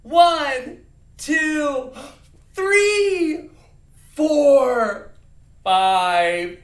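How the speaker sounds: high, booming cheerful count; happy. Shown as a demonstration. Teacher leading a happy counting game, happy. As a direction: punch each number out with glee. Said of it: "We're being happy, remember?".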